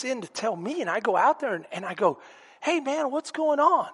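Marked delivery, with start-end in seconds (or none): 2.6-3.9 lightly acted higher-pitched hello; naive friendly greeting. Walking out to his brother's bully, naive friendly greeting. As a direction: greet him higher, suspecting nothing.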